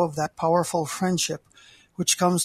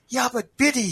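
sad voice; 6 semitones higher than usual. Acted. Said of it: "he said in a sad voice".

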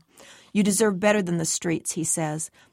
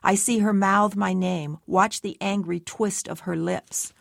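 ordinary delivery

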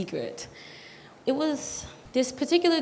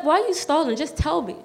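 higher-pitched, exasperated push to get an answer; impatient demand; 6 semitones higher than usual. Retold or imitated. Girl urging her stalling friend to just tell her, impatient. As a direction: press them to spit it out, pitch up.

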